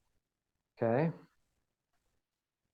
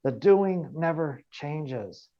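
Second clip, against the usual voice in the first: "The doing never changes" said slowly, pitched up; emphatic; 4 semitones higher, 4 dB louder, slower than usual. Real, not acted. Coach driving a key point home to trainees, emphatic. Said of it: "I want to really emphasize that".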